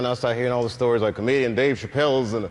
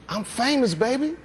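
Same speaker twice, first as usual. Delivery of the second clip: cocky boast; 10 semitones higher than usual. Retold or imitated. Imagined boast on seeing celebrity.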